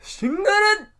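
high loud mock shout; hammed-up shout; silly call-out. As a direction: call it out like a goofy battle cry. Calling out mock name.

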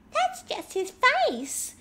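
high, slow, childish mimicry of a cast member; mocking imitation. Host imitating the cast member mocking Cassandra, high and slow. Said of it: "And then she mocks Cassandra".

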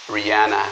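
Rihanna said the English way, ree-AH-na; English pronunciation. Shown as a demonstration. Teacher models the name Rihanna for learners, in English. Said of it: "você vai ouvir [name]".

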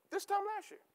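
a soft, high, wondering echo of the phrase; hushed, high-pitched repeat. Acted.

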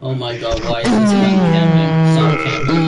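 yelling at camper. Real, not acted. Gamer yelling at an enemy camper, loud and exasperated. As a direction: shout at him to stop camping.

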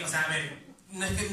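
normal talking voice

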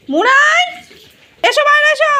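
high, loud singsong coaxing the dog; pet baby talk. Acted. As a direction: talk to him like a toddler, high and bright. Calling dog out of bathroom. (উঁচু গলায় আদুরে সুরে কুকুরকে ডাকা)

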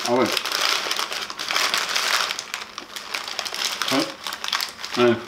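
chewing while talking; talking between bites of taco; mouth full. Real, not acted. Seen 1: bit taco at -4s, jaw working, taco held throughout.

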